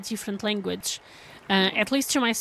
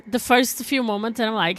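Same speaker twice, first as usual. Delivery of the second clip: usual speaking voice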